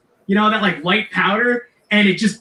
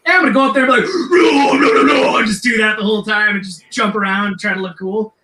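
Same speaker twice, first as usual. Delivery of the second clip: mock rock-singer shout; 4 semitones higher than usual. Drunk singer planning his stage act, hammed-up shout. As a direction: belt it like a goofy metal frontman.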